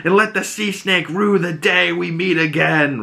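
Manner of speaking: high, declaimed theatrical threat; mock captain's vow. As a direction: swear revenge, hammed up. Retold captain's rallying vow.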